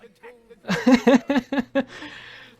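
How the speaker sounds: high, loud burst of laughing; gleeful cackle after trash talk; excited laughter. Real, not acted. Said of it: "I got really excited there".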